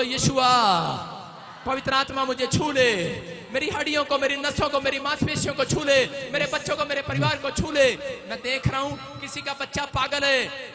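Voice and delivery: loud prophetic prayer; fervent healing prayer. Acted. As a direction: proclaim healing loudly.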